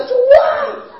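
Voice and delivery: very high, loud wailing shout; all-out mock cry. Imitating congregants crying out under the crucifixion film, straining shout. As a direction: scream to the Lord, imitating desperate weeping. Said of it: "一定到那儿底下极力喊".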